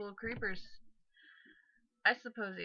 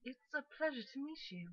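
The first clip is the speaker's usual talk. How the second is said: soft, higher British character voice; British accent; 6 semitones higher, 5 dB quieter than usual. Acted. Brother meeting the roommates, soft British accent. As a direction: greet politely in a lilting British accent. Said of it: "like, British".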